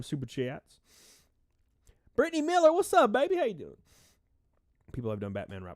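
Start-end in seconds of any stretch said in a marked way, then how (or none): 2.2-3.8 excited greeting. Real, not acted. Calling out a viewer's name.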